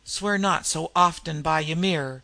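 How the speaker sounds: usual speaking voice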